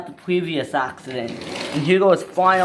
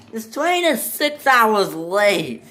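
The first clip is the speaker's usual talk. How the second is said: slow, higher-pitched complaint; annoyed; 6 semitones higher, much slower than usual. Acted. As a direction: complain crossly, drawing it out. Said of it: "The passengers were very annoyed".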